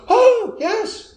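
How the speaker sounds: loud, high-pitched comic "oh"; sudden realisation. Retold or imitated. Mother reminded she is in Holland, sudden realisation. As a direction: burst out as if just reminded.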